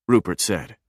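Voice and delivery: ordinary delivery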